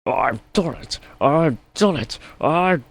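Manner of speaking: high, loud triumphant engine; proud Gordon. A narrator's reading. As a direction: crow with pride, building. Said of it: "he said proudly".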